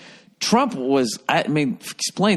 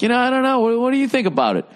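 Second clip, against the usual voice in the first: mock innocent deflection; 10 semitones higher, faster than usual. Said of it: "I would just be like".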